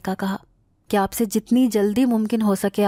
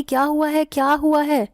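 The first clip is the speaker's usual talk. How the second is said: anxious repeated call, pitched higher; worried calling; 6 semitones higher than usual. A narrator's reading. Aunt calls anxiously up to her screaming niece. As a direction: call out in alarm to the screaming girl. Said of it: "پریشانی سے مجھے آوازیں دے رہی تھی".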